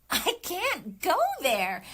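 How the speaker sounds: big insistent read; emphatic take. Shown as a demonstration. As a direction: throw it out with full force. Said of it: "So I would do".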